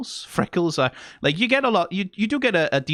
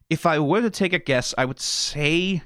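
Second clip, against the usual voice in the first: ordinary talk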